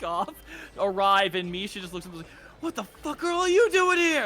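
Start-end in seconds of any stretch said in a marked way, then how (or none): 2.6-4.2 high, loud outraged demand; angry confrontation. Acted. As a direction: tear into subordinates at full voice. Misha confronts the returning guards.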